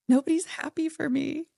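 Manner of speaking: high-pitched mock crying complaint; acted upset, voice pushed way up; retold tearful upset. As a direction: imitate wailing to a therapist. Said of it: "I was really upset, crying on his couch".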